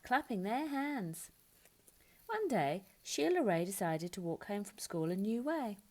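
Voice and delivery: ordinary delivery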